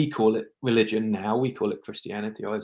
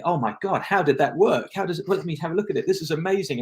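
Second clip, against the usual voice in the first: higher and fast, hammed-up amazement; mock excited fuss; 7 semitones higher than usual. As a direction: imitate someone making a fuss, lightly. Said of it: "scream and shout and make a fuss of it".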